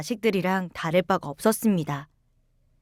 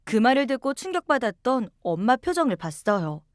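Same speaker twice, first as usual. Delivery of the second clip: ordinary delivery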